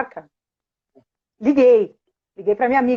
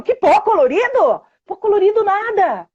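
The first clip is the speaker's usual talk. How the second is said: mock indignation; 9 semitones higher, 3 dB louder than usual. Mother voiced playfully.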